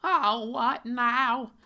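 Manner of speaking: high, loud mocking nerdy whine; hammed-up high whiny man voice. Comic hero speaks, high voice.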